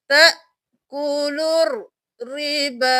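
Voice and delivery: loud, slow तअकुलु; Quranic recitation. Shown as a demonstration. Teacher reciting the joined reading. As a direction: chant तअकुलु रिबा with silent waw and alif. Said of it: "चार अलिफ के बराबर खींचेंगे".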